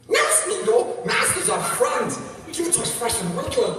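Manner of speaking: high, creepy creature voice; loud, high, creepy little creature; Gollum. Acted. Showing the audience a party-trick voice, creepy Gollum. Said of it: "You know Gollum, Schmeek, little creepy thing?".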